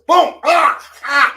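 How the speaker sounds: loud, high boom and yelps; sudden mock cries of a man being struck; punchy sound effect, then pained "ah, ah"; acted impact cries. Acts out the hit and choke.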